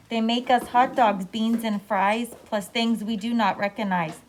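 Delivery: plain reading voice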